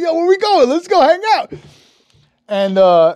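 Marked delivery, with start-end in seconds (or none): 0.0-1.5 loud, high excited invitation; eager tagging along. Retold or imitated. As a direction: call out to a buddy, hyped up. Retold eager companion.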